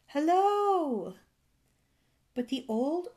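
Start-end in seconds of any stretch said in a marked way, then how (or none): 0.1-1.2 loud, high, drawn-out call; shouted hail. A narrator's reading. Fairy calls to the old man on the steeple, shouting. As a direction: call up to someone who won't answer. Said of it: "and shouted".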